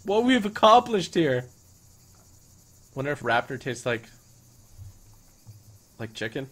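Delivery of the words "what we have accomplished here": very high, slow mock-tearful wail; mock crying. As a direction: pretend to cry with joy. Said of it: "You guys are making me cry".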